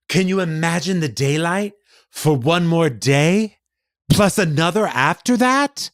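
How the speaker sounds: slow, high, lilting recitation; poetry recital. Retold or imitated. Actress reciting a poem for shoppers, lofty recital. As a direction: imitate an earnest poem, stretching each phrase. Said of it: "The poem was three lines long".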